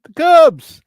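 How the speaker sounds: high, loud announcer call; announcer yell. Acted. Host announcing the Cubs segment with a high loud yell. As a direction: boom it like a ring announcer.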